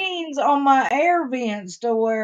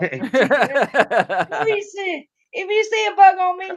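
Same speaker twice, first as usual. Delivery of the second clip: nervous plea; 7 semitones higher, 6 dB louder, slower than usual. Real, not acted. Bug phobia, pleading.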